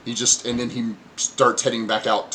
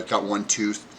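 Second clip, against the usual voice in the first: usual speaking voice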